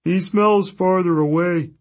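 moaning Cyclops. Acted. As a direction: moan it like a sad simple giant. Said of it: "the Cyclops moaned".

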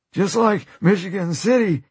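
high, cracked glee; gleeful dying man. Acted. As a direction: crow it out through the pain. Dying Jack laughing, reminiscing.

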